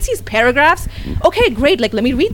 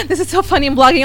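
giggly quote; 6 semitones higher, faster than usual. Retold or imitated. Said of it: "At that time, I'm like".

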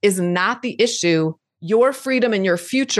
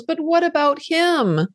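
worried little inner part; 7 semitones higher than usual. Retold or imitated. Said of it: "raises her little head and goes".

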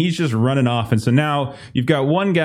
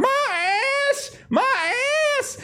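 much higher pained wailing; crying out in pain; slower than usual. Acted. Burned farmer wails after stumbling from the wagon. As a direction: howl about your burned backside.

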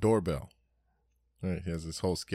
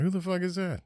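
high, far-off put-on voice; mock startled grumble; 12 semitones higher than usual. Himself reacting to a knock at his door, high mock alarm. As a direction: growl at the knock like a suspicious host.

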